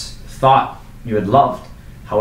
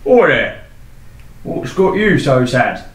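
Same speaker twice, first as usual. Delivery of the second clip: loud, higher, cheery local; hearty townsman; 4 semitones higher, 4 dB louder than usual. Acted. Townsman hailing the sad knight in the inn. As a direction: call out to him like a pub regular.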